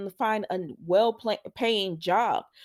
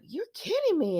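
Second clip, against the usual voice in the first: high-pitched imitated astonishment; retold incredulous reaction; mock disbelief; 10 semitones higher than usual. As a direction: act out his shock, voice pitched up.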